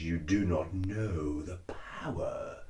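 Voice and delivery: low, slow, soft menacing Vader; slow and in control, like Vader; a cosplayer sliding into his Vader voice; Darth Vader voice. Shown as a demonstration. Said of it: "I forgot how to sound like, like Vader".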